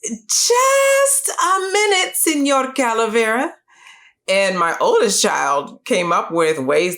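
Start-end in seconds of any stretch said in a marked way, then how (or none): 0.0-3.5 much higher and slow, drawn-out put-on granny voice; high, slow mock old-lady voice; playful Grandma Beetle. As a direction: stretch it out high and slow like a teasing grandmother stalling. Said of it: "My kids love to mimic all the different ways Grandma Beetle might have said".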